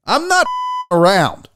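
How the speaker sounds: firm line with the swear bleeped out; censored, emphatic repeat; bleeped redo. Pretend. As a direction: restate it, censor beep over the curse.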